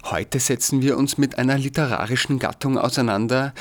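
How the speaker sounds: ordinary talk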